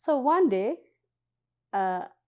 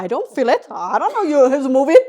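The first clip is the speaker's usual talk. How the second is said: loud protest; 5 semitones higher, 11 dB louder, faster than usual. Retold or imitated. Arguing with translator in elevator.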